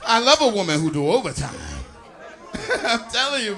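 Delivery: ordinary talk, nothing marked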